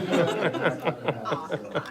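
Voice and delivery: put-on joking Brooklyn accent, laughing. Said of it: "so I'm just gonna speak Brooklyn".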